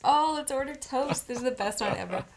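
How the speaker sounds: loud, high delighted exclamation; excited. Real, not acted. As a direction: burst out with glee.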